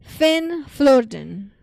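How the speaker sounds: loud, very slow mock German accent; attempted German accent. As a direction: try a German accent, heavily drawn out. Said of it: "I'm trying to do German".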